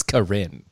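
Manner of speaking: low "Corinne", stressed on the end; corrected name. Shown as a demonstration. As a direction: repeat "Corinne" as kuh-RIN, pitched low. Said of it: "Oh, Corinne".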